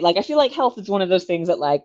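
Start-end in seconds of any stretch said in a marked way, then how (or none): none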